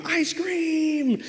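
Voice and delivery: put-on high, drawn-out kid's holler; kid's ice-cream scream. Neighborhood kid alerting everyone to the truck, mock scream. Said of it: "one kid who would scream".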